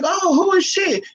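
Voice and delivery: high, slow, put-on friend's voice; drawn-out curious question in a high voice.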